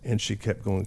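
ordinary talk

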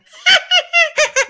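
very high, loud puppet giggle; the Tickle Me Elmo laugh. Shown as a demonstration. Showing the only Elmo bit he can do, a high giggle. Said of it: "I could only do that".

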